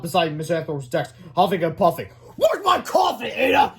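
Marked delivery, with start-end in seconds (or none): 2.4-3.7 high, loud angry shout; grumpy shout. Acted. Principal shouting at his secretary. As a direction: bellow for coffee. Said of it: "he shouted".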